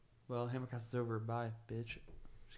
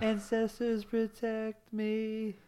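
very slow, higher ritual prayer; solemn incantation; 8 semitones higher, much slower than usual. Acted. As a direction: intone it like a sacred oath. Movie quote in solemn voice.

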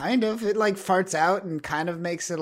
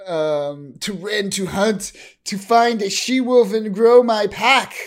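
loud, slow dramatic monologue, rising to a peak; apocalypse monologue; 4 semitones higher, 8 dB louder than usual. Retold or imitated. Grey Crow ranting about his pack, mock apocalyptic monologue. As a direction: declaim it, building higher and louder. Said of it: "like, a fucking apocalypse monologue".

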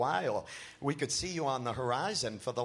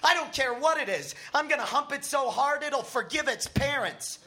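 excited; 9 semitones higher than usual. A narrator's reading. Matt excited about the hitchhiker.

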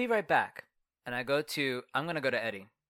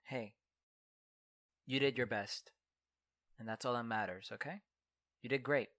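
soft, low, gentle reassurance; comforting; 7 semitones lower, 10 dB quieter than usual. Acted. Comforting crying Anita, soft and low. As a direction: soothe a crying friend quietly.

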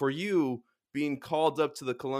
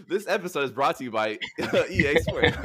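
laughing mock ad read; 5 semitones higher, 5 dB louder than usual. Joking fake sponsor read.

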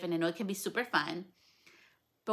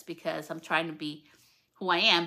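ordinary delivery

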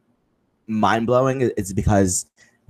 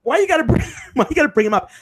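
high exasperated whine; faster than usual. Retold or imitated. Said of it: "I'm like".

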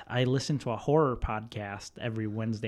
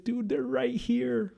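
high, drawn-out scared plea; frightened friend; 10 semitones higher, 4 dB quieter, slower than usual. Retold or imitated. Scared friend waking sleeper to see ghosts, frightened. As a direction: imitate a spooked friend, slow and high.

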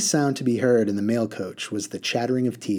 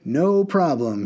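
higher, slow, cheerful call; 5 semitones higher, 4 dB louder, slower than usual. Acted. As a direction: shrug off the worry loudly. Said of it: "he shouted gaily".

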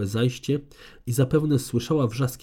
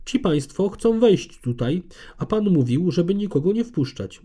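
apologetic guard; 7 semitones higher than usual. A narrator's reading. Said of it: "usprawiedliwiał się tęgi, wąsaty ochroniarz".

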